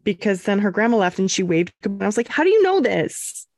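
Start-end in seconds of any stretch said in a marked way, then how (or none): 2.3-3.5 put-on a high, amazed question to the baby. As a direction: marvel at her, pitch jumping up. Amazed by baby's first wave.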